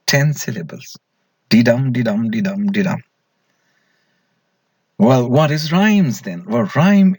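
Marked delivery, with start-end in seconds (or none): 1.5-3.0 sing-song iambic rhythm, "dee" light, "dum" heavy; iambic beat demo. Shown as a demonstration. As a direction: tap out the da-DUM rhythm, each "dum" hit hard. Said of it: "light or heavy stress pattern".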